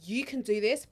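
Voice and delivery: voiced, matter-of-fact pep talk; firm encouragement. Acting the no-nonsense midwife to herself, firm encouragement. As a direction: tell her plainly she can do it. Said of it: "I need a midwife that's gonna be like".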